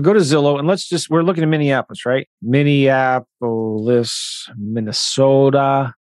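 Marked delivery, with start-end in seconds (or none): 2.4-4.5 slow, drawn-out Minnesota Minneapolis; Minnesota accent. Shown as a demonstration. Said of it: "'cause that's how they pronounce it". 4.6-5.9 slow Minnesota "Minnesota" with a falling end; Minnesota accent. Shown as a demonstration. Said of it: "They say it really slow".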